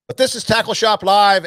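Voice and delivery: host hyping the show title; show name callout. Real, not acted. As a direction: announce the show name with punch.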